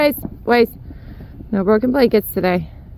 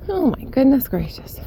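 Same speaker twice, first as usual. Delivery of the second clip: ordinary talk, nothing marked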